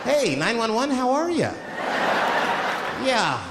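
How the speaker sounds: breezy, sociable phone voice, drawing out the details; cool, relaxed caller. Acted. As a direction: act out an absurdly relaxed emergency call. Acts out absurdly calm 911 call.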